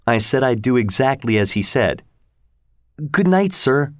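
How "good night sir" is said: put-on higher, polite child's farewell; meek, eager to please; timid boy. Pip saying good night to the convict, high and timid.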